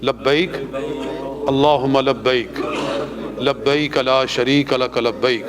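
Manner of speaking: slow, drawn-out talbiyah for students to repeat; slow recitation. Shown as a demonstration. Teacher leading students in the talbiyah. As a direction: lead the words slowly. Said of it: "میرے ساتھ مل کر پڑھ لیں".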